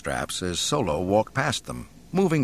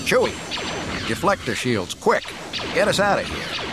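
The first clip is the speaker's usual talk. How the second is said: raised, urgent call to the crew; urgent yell; 7 semitones higher than usual. A narrator's reading. Solo yelling forward to Chewie under fire, urgent yell. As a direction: call forward in a hurry, voice pitched up. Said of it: "turning to yell forward".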